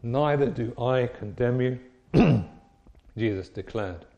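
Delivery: measured, solemn Bible reading; scripture reading. Acted. Reads scripture aloud.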